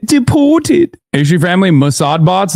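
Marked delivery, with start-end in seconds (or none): 0.1-1.0 mock British "Deported." pitched high and held; crisp high British "Deported."; British accent. Repeating the Brit's "Deported." in a high mock British voice. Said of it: "My man said".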